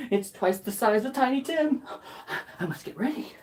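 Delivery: ordinary delivery, nothing marked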